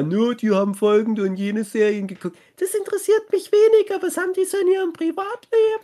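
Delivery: very high, fast wife voice; squeaky wife impression. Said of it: "Und dann fangen immer".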